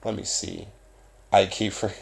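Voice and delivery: usual speaking voice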